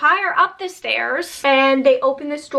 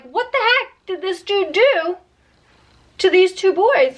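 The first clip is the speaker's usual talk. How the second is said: higher, indignant townsfolk voice; outraged townsfolk; 5 semitones higher than usual. Retold or imitated. Townspeople demanding answers, indignant and higher. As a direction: play the shocked town crowd.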